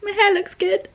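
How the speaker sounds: high, teary laughing voice; real crying breaking into high laughter; emotional. Real, not acted. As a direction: laugh through happy tears. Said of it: "I don't know why I'm getting emotional".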